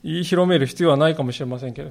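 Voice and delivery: ordinary delivery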